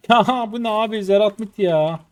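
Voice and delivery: high, loud disbelieving outburst; frustrated shout. Real, not acted. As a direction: yell it out in pure disbelief. Frustrated gamer shouting at screen. (öfkeli bağırış)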